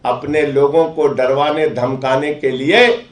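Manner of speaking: ordinary talk, nothing marked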